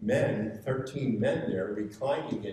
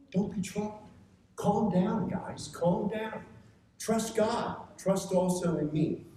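urgent reassurance; 10 semitones higher than usual. Acted. Preacher voicing Jesus comforting disciples.

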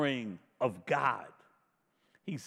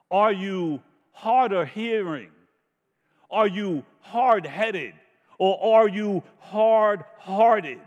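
rising preacher challenge; 3 semitones higher, 6 dB louder than usual. Real, not acted.

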